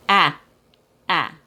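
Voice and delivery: Ah with the jaw half down and the tongue flat; flat open Ah; vowel demo; short A demo. Shown as a demonstration. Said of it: "You drop the jaw halfway down".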